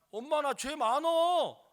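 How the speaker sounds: loud, high, drawn-out protest; child insisting. Retold or imitated. Child answers mother in story.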